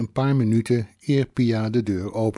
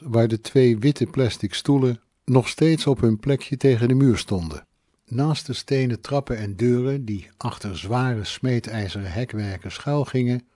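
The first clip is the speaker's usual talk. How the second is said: ordinary delivery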